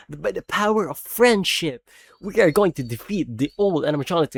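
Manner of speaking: hammed-up cartoon hero rallying; mock heroic. Parodying the animatronics' rally, hammed-up heroic. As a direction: declaim it like a corny superhero.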